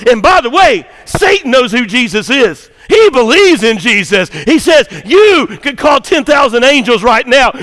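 high, shouted sermon climax; full-force preaching. Acted. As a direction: cry out the tempter's challenge at full voice. Preaches Satan's taunt at full force.

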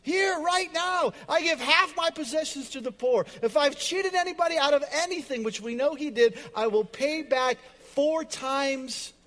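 loud, high, joyful vow; high and loud, thrilled to give it all; excited declaration. Acted. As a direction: shout it out like a man overjoyed. Zacchaeus stands up, excited.

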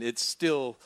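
usual speaking voice

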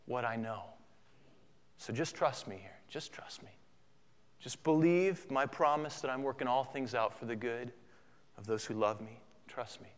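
soft, low, intimate reassurance; reassuring Christ. Acted. Christ asking us to trust his promise, hushed. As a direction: drop low and quiet, almost whispering at times. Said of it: "Christ's message to us in our circumstances".

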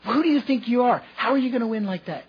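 hammed-up coach shouting at a kid; imitating yelling coach. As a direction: bark it like an angry youth coach. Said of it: "he's yelling at me".

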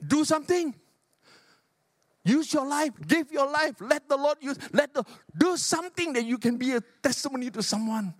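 rally the church to act; fervent preacher calling to action; preaching at full force. Acted. Preacher exhorting the congregation.

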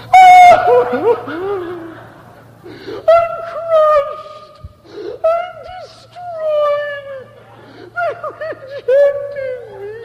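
high, hammed-up wail of self-pity; mock wounded worship leader. Pastor mocking a sidelined worship leader, high mock wail. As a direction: overplay the hurt singer's sobbing complaint. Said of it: "they look at you and say".

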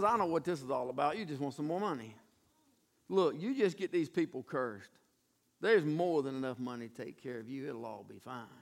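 lightly voiced knowing briber, low and soft; Balak.

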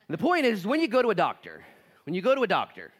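loud, high, driving preacher voice; fired-up sermon delivery; preaching at full force. Acted. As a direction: preach it out to the room.

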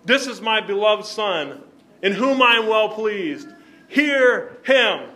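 heavenly decree, rising to a shout; booming Father's voice. Acted. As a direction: thunder it out as God from the cloud. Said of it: "a voice comes out of the cloud".

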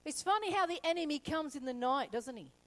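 ordinary talk, nothing marked

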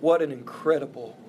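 ordinary talk, nothing marked

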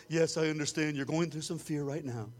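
low, soft mock counselor voice; a hammed-up soothing counselor. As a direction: imitate a gentle therapist.

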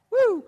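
a very high, loud whoop of delight; ecstatic revival whoop. Retold or imitated. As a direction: let out a crowd's excited cheer. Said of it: "you're just".

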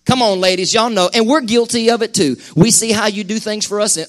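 normal talking voice

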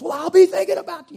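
loud, high and fast; loud mock comfort. Shown as a demonstration. Preacher mocking a loud hollow reply to a migraine sufferer. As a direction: blurt the empty phrase far too loudly. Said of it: "you know, loud".

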